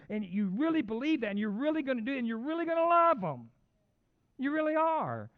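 building to a high, loud peak on love; emphatic preaching. Real, not acted. Preacher urges the congregation to love others, emphatic.

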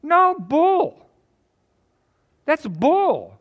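loud scornful rebuttal; preacher loudly rejecting the arrogant teaching; indignant outburst. Real, not acted.

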